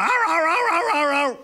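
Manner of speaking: high mock barks; yappy imitated barks; imitated dog barking. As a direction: bark like a straining dog. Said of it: "he's going".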